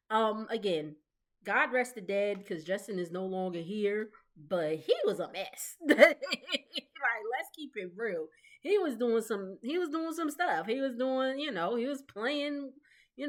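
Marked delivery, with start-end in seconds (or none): 5.8-7.0 quick high giggle; loud, high chuckle; laugh. Real, not acted. Amused at her own remark.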